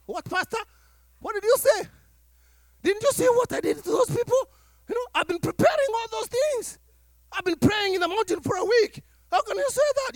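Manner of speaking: high, indignant protest; indignant offended man. Retold or imitated. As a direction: sound wounded and defensive. Offended man rebuffing the pastor.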